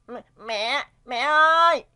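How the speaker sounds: calling out. Acted. Child calling loudly for mother in the morning. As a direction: shout for mom from another room.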